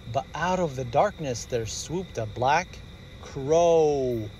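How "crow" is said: plain reading voice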